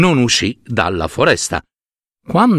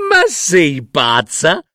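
mock the old woman with contempt; girl sneering at the old woman's poor food, high and scornful; scornful mockery; 4 semitones higher than usual. A narrator's reading. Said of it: "la ragazza scoppiò a ridere ancora".

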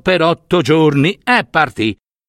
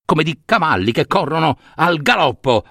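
ordinary delivery

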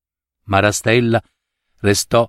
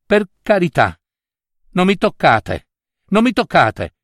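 higher, pleading cry of fright; frightened cry; 8 semitones higher than usual. Acted. Bride cries out to don Lisi in fright. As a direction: cry out in fear, begging him to stay back. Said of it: "gli gridò".

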